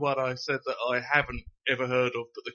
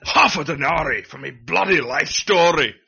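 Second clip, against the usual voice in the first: loud outraged Glaswegian bellow, louder; Billy Connolly rant; 3 semitones higher, 10 dB louder than usual. Acted. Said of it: "I went a bit Billy Connolly".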